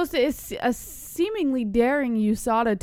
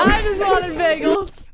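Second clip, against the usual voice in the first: whiny mock voice; 7 semitones higher, 5 dB louder, slower than usual. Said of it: "That's the best part".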